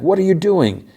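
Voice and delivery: high retold outcry; alarmed. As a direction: imitate a horrified coworker protesting. Coworker confronts attacker.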